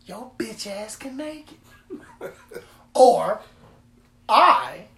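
slow loud mock threat; mock tough challenge. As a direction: call him out like a wannabe street fighter. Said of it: "So when I be like".

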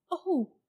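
high, loud pained gasp "Oh"; a boy stung by the thought of his mother, gasping; hurt gasp. A narrator's reading. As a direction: gasp as if struck. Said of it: "gasped Diggory".

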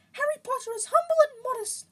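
reverent, very high loud elf; Dobby. A narrator's reading. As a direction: squeak it with awe in falsetto. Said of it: "Dobby reverently".

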